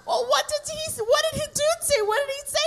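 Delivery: high, laughing rapid-fire questions; giddy retold interrogation; mock excited questioning. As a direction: pepper her with eager questions.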